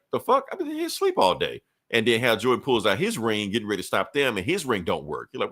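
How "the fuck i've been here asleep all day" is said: squeaky high outraged voice, way up; outraged disbelief. Retold or imitated. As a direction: yelp it in shocked disbelief. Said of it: "They're like".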